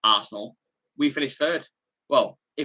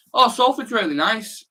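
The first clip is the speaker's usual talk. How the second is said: higher, slower, mock-gushing praise; mocking naive outsider; 9 semitones higher, 4 dB louder than usual. Streamer imitating outsiders praising Salford, light high mock gush. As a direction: lightly imitate someone who knows nothing. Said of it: "people go".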